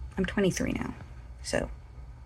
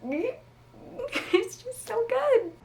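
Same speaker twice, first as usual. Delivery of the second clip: high, slow laughing delight; laughing gush; much slower than usual. Real, not acted. Vlogger gushing to viewers about her book, laughing.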